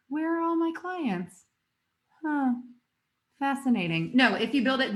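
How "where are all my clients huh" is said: mock bewildered. Same naive freelancer, now puzzled.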